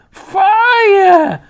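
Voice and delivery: high, loud crone yell. A narrator's reading. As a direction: scream the alarm. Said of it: "the old woman yelled".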